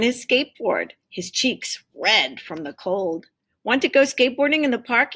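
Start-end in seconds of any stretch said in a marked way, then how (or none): 3.6-5.1 quick, high and bright invitation; eager friend. Acted. Mike on his skateboard asks Tim eagerly. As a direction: rush the question out, keen to go.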